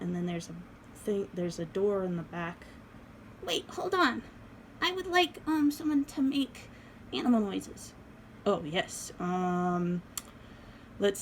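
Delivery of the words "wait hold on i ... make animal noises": doll voice. Acted. Doll asks for animal noises.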